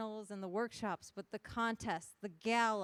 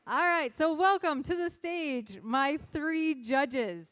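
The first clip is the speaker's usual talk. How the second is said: loud, slow, high stage introduction; announcer call; 5 semitones higher, 8 dB louder, slower than usual. Real, not acted. MC welcomes three judges to the stage, announcer call. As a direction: stretch it out big for the crowd.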